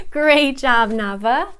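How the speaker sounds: put-on reward a small child with big enthusiasm; gushing teacher praise. Shown as a demonstration. Teacher giving clever student a popsicle, gushing. Demo online phonics class.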